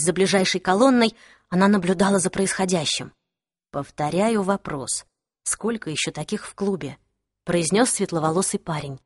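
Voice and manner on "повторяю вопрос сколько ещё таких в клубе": measured interrogation. Acted. Blond boy interrogating a captive, measured. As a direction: keep it low-key and deliberate, pressing for an answer.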